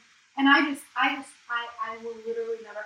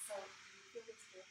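softer, breathy near-whisper; hushed question; 2 semitones lower, 18 dB quieter than usual. Retold or imitated. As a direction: ask it almost under your breath, shy. Asking crush if they feel it.

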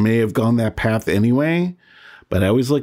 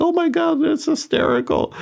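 high-pitched mock delight, imitating mom; squealed, hammed-up amusement; amused mother. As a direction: imitate a mom finding it hilarious. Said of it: "like, laughing. She thinks, she's like".

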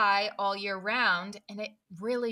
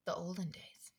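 whisper; 12 dB quieter than usual. Pretend. Trailing off dreamily.

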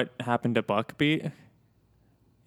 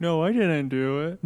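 put-on childish denial, a bit higher; guilty kid fibbing; 3 semitones higher, 3 dB louder than usual. Kid lying to orthodontist.

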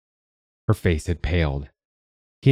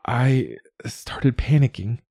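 slow, high strained voice; straining to speak; 6 semitones higher, slower than usual. A narrator's reading. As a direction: force words from long-unused vocal cords. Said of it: "long unused vocal cords still straining".

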